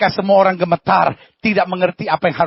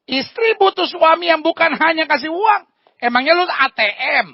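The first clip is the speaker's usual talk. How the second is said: higher, louder sarcastic jab; mocking; 10 semitones higher, 3 dB louder than usual. Pastor mocks husbands who only give money, higher and louder. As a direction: poke fun at the husband.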